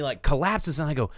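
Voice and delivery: normal talking voice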